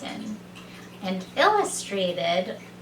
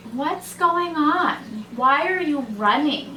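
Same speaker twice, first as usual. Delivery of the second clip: sleepy bear; 5 semitones higher than usual. A narrator's reading. Sleepy bear questions the rabbits.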